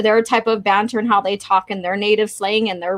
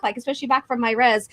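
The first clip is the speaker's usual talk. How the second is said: normal talking voice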